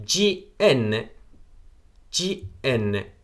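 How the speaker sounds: G N drawn out letter by letter; teacher spells G N for the class; letters spelled very slowly. Shown as a demonstration. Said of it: "还有".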